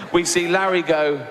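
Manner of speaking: ordinary talk, nothing marked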